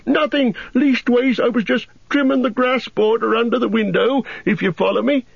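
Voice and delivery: put-on a flustered young gardener caught out, pitched high; Sam, flustered. A narrator's reading. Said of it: "said Sam".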